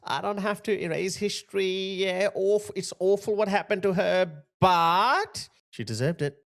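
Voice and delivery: high, sneering fake-reasonable voice; mocking a commentator who claims to be reasonable, high fake-nice voice; mocking impression. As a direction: parody a smug activist pretending to be kind. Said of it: "they pretend to be like".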